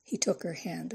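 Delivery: usual speaking voice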